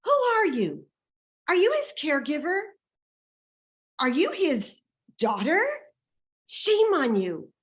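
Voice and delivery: high, loud, accusing questions; outraged confrontation. Retold or imitated. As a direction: fire accusing questions at her, then shame her. Retold confrontation of the woman.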